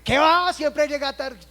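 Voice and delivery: high, loud mock scolding of a latecomer; scolding yell. Church server yelling at a latecomer. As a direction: snap at the one who comes late.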